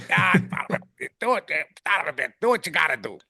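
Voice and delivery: lightly voiced cranky old fishing guide; Freddie's gruff voice. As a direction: toss off the cussing like Freddie always did. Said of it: "That's how he talked".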